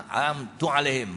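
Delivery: ordinary delivery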